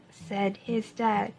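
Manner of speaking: put-on gentle picture-book voice for young listeners; storybook reading. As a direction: read it like story time in class. Reads a picture book to children.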